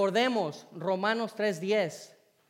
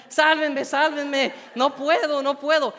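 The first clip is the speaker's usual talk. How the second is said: higher, louder panicked cries for help; screaming for help; 6 semitones higher, 8 dB louder than usual. Retold or imitated. Drowning student begging lifeguards to save him, panicked shout. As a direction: yell it like you're going under. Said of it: "empecé a gritar".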